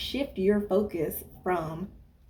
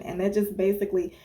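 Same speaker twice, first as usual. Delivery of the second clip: normal talking voice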